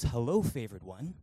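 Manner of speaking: lightly voiced angel, hushed and low; angel greeting. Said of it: "the angel appears before Mary and goes".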